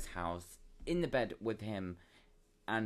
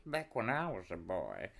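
put-on old-timer storytelling; 2 semitones lower, slower than usual.

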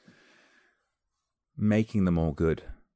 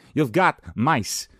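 higher, louder and slow, stretching got; hammed-up Walken, dragging each word; big slow punchy Walken line; Walken impression; 7 semitones higher, 5 dB louder, much slower than usual. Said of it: "and goes".

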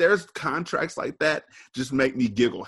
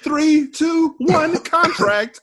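higher and louder, slow dramatic countdown; mock countdown; 6 dB louder, much slower than usual. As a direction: announce it like a big reveal, drawn out.

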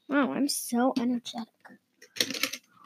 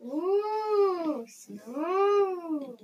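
vintage echo chamber; 5 semitones higher, 5 dB quieter than usual. Real, not acted. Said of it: "Can I try?".

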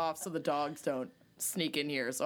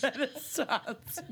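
laughing; 6 semitones higher, slower than usual. Pretend. Mocking correction laughing.

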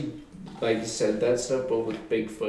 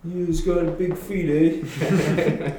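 mock British accent; 4 semitones higher than usual.

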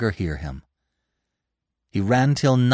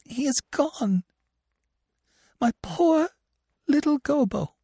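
high, grieving lament rising as it goes; a mother breaking down over her lost son; desolate, tearful plea; weeping; 10 semitones higher than usual. Acted. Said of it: "Ina was weeping".

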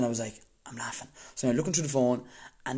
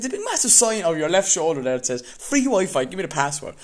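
loud, higher retold indignation; exasperated demand; 6 semitones higher, 6 dB louder than usual. Pointing out the free Wi-Fi sign to clerk, indignant. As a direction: jab at the sign, fed up.

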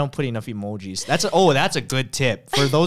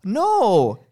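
bright, high upbeat reply; cheerful no; 9 semitones higher, 6 dB louder than usual. Retold or imitated. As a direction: sound happy and fine. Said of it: "Exclamation point, happy face".

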